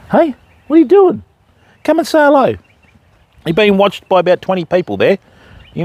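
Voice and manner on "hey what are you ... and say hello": higher coaxing call to a dog; dog talk. Real, not acted. Fisherman calling the farm dog to him, higher coaxing voice. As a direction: call the dog in a lifted sing-song.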